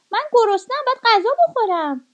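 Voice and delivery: a starving wolf insisting he must eat; hungry wolf. Acted.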